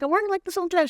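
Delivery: high, sheepish protest; defensive self. Retold or imitated. Herself to her friend, defending the dress in a high voice. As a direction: squeak out the excuse.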